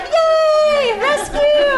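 very high, loud cheer; joyful cheer. Retold or imitated. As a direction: cheer like delighted nuns. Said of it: "And they're like".

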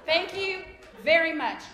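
dry, slow sarcastic thank you; mock gratitude, drawn out.

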